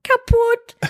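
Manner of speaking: put-on very high insistent child voice; tiny high-pitched reply; imitating toddler son. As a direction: mimic a toddler's one-word verdict. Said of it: "meint dann so zu mir".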